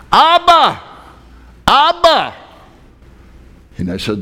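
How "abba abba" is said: high, loud imitated child's call; child yelling for dad. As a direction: yell out for Daddy like a little boy. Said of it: "starts to yell for his dad".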